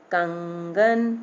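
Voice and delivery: कंगन very slow, the कं held in the nose; nasal sound. Shown as a demonstration. Teacher demonstrates nasal sound, कंगन slow through the nose. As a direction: stretch कंगन, humming the first syllable nasally. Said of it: "नाक से निकल रही है ना आवाज़".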